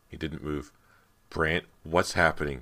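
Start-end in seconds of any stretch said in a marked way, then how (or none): none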